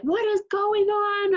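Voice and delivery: high, slow bewildered voice; mock confused self. She imitates herself lost in a movie, high and slow. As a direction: play it up as lost in the plot.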